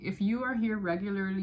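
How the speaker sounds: ordinary talk, nothing marked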